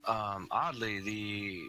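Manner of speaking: slow scene-setting narration; game master narration. Acted. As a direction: set the scene for the players.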